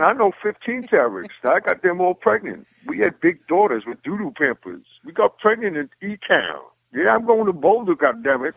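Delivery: high goofy comic rant; Tracy Morgan voice. Shown as a demonstration. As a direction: pitch way up and ramble like Tracy Morgan. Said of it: "Tracy Morgan".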